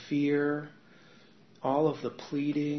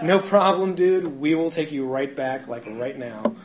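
eager friends; 2 semitones higher, 10 dB louder than usual. Retold or imitated. Friends agreeing to take him back to rehab, loud and breezy. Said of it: "They're like".

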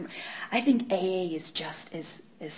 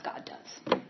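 hushed; 8 dB quieter than usual. Real, not acted. Answering herself.

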